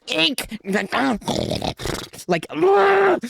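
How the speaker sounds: high anguished cry; frustrated scream. Real, not acted. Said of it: "that's the last crash out".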